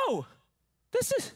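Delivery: high, emphatic disbelief; incredulous objection. Acted. Preacher acting John's doubt about Jesus, high incredulous protest.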